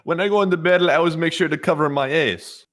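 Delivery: hammed-up soldier boasting; mock soldier. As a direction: say it like a proud grunt. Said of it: "the Ukrainians said".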